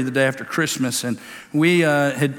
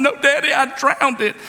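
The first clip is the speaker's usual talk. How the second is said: put-on higher, whiny little boy; a hint of a sobbing child; upset toddler voice; 10 semitones higher than usual. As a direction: play the scared three-year-old, lightly. Son insists he drowned.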